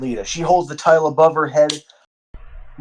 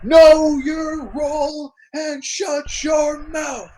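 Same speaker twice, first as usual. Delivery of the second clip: The Rock impression; 9 semitones higher than usual. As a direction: bellow it like a wrestler cutting off a rival. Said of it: "And The Rock says".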